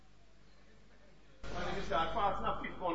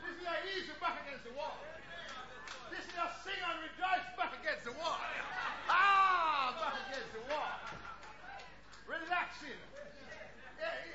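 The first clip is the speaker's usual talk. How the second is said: laughing; 7 semitones higher than usual. Real, not acted. Laughing through playful preaching.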